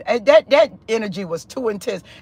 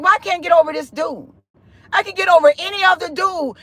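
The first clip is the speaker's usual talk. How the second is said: higher-pitched, baffled complaint; retold frustration, pitched up; exasperated self-questioning; 6 semitones higher, faster than usual. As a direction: reenact being fed up with yourself. Retells frustration at not getting over him.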